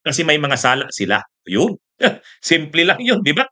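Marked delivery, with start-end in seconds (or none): none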